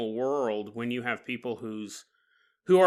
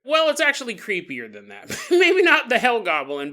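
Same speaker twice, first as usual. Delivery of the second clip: usual speaking voice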